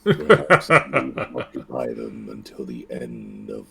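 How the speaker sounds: a player parrots the dark voice, ham-style; mock dark voice. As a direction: sink into a sinister low rumble. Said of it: "so buttery smooth".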